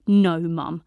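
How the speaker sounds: loud, firm no; firm refusal. A narrator's reading. Daughter defying Mum in the corridor, firm. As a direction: plant your feet and refuse.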